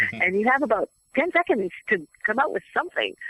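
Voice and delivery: ordinary talk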